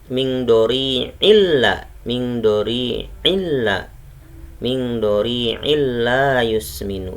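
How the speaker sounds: drawn-out tajweed chant of the verse; slow Quran recitation. Shown as a demonstration. Teacher reciting a verse for students, slow and measured. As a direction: recite slowly, stretching illa. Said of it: "Bacanya seperti itu".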